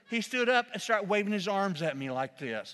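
ordinary delivery

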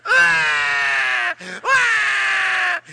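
loud mock wail; high, loud, put-on bawling; imitated child's crying. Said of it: "He starts crying".

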